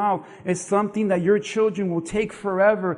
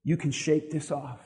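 gentle father; 3 semitones lower, 3 dB quieter than usual. Acted. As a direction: let the promise land quietly. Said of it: "notice what he said".

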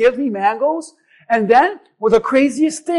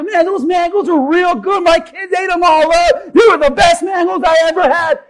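higher, delighted neighbor; happy; 8 semitones higher than usual. Retold or imitated. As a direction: gush over the mangoes. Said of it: "she was all happy".

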